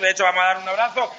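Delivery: ordinary talk, nothing marked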